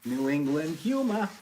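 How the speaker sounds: put-on playful New England accent, pitched high; New England twang thrown in as a joke. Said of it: "Your accent just came out".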